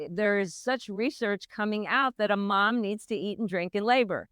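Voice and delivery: usual speaking voice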